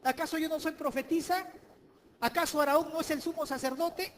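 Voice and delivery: indignant challenge. Acted. Preacher voicing Miriam's jealous protest, loud. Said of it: "Esta mujer decía".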